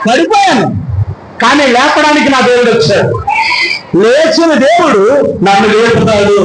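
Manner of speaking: high, ringing preacher's proclamation; a pastor crying out triumph over the enemy; fiery preaching. Acted. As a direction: build the crowd up with rising fervour. Preacher rising to full force.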